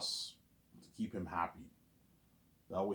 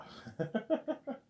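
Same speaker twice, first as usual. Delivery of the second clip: high giggle at his own bad impression; laughing at himself; high-pitched laugh. Real, not acted. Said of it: "Bad impression, though".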